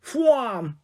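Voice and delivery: "Fwam" high and sharply falling, like a whoosh; the woman demonstrates the way to say "Fwam"; action-word exclamation. Acted. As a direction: fire "Fwam" off like a rocket leaving. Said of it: "said Derek".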